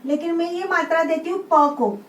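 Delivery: usual speaking voice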